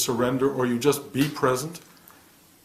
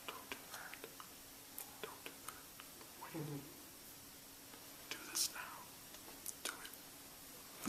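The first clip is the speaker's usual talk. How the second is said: hushed, breathy whispered commands; inner voice whisper; 27 dB quieter than usual. Retold or imitated. The quiet inner voice guiding him, in a soft whisper. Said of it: "That's how it comes".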